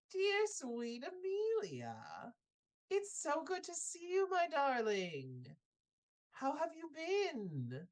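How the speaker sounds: put-on high, slow, sugary old woman's voice; sweet old lady. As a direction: coo at the girl like a kindly grandmother. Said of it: "she looks at you and she says".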